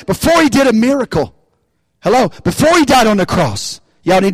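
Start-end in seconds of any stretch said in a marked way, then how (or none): none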